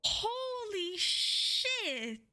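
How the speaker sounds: high-pitched, drawn-out shock; real astonishment, voice shooting up; stunned disbelief. Real, not acted. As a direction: gasp it out high, floored by a huge gift. Stunned by a surprise donation.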